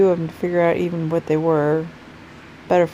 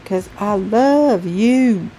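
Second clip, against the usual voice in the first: affectionate sign-off; 3 semitones higher, 5 dB louder, much slower than usual. Real, not acted.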